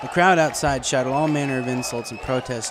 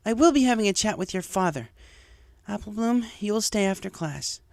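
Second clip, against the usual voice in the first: higher, firm disappointed teacher; stern teacher; 10 semitones higher than usual. Acted. Teacher hands out punishments, firm and disappointed. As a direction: lay down the punishments.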